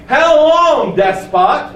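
high, loud cry on long; pleading outcry. Shown as a demonstration. Preacher shows martyrs crying out to God, loud. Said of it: "That's how they call God".

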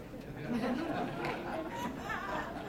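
high amused laugh. Real, not acted. Preacher laughing at his imagined Elijah retort. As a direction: burst into a laugh at your own joke.

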